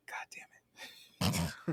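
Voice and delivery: imitating a worn-out mutter; weary whisper. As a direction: sigh it out like a tired cop. Stabler reacting, retold in quote.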